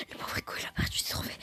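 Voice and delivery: breathy whisper of pity. Real, not acted. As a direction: whisper it sadly, aside. Pitying Rico's lost trophies.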